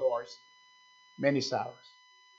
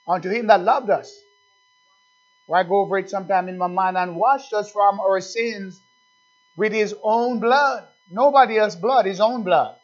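slow, louder reading of the verse; intoned Bible verse, slow and loud; scripture reading; 4 semitones higher, 5 dB louder than usual. Acted. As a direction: lean on each word of the verse. Preacher reading scripture with force.

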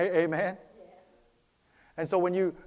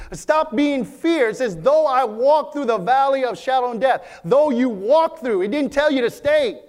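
louder, climbing sermon cadence; full-force preaching; 5 semitones higher, 6 dB louder than usual. Acted. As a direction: preach it out to the congregation, building.

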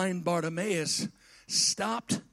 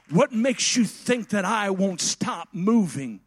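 rising, loud preacher's fervor; full-voiced pulpit preaching; 8 dB louder than usual. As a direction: preach it out to the congregation. Preaching God's own words.

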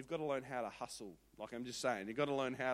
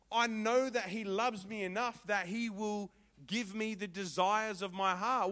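1 earnest faith; 5 semitones higher, 6 dB louder than usual. Retold or imitated. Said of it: "rose up in Helena's heart".

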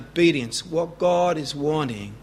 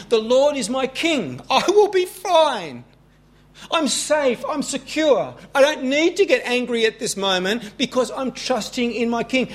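reassuring self-talk; 7 semitones higher than usual. Pretend. Preacher voices trusting self-talk.

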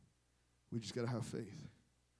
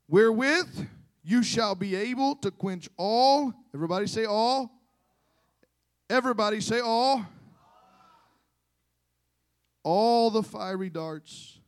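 rally the congregation, leaning hard and long on key words; forceful preaching; 6 semitones higher, 12 dB louder than usual. Real, not acted.

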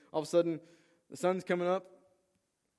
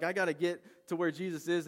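higher, louder eagerness; urgent, eager retold outburst; excited; 5 semitones higher, 6 dB louder than usual. Peter desperate to reach Jesus, excited. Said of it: "He's so excited".

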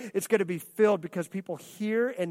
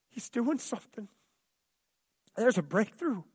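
usual speaking voice

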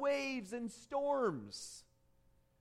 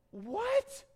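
very high-pitched astonished question; sudden high yelp of amazement; incredulous; 11 semitones higher than usual. Acted. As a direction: squeak it up in disbelief. Peter's disbelief on water.

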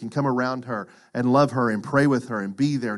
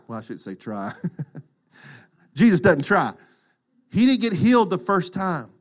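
normal talking voice

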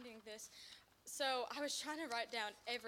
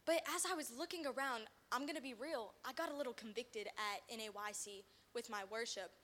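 ordinary delivery

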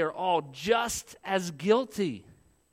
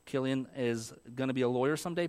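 normal talking voice

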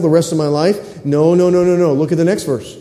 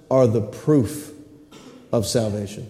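hushed emphasis; 8 semitones lower, 8 dB quieter than usual. Real, not acted. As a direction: let it sink in quietly. Contrast price vs proof.

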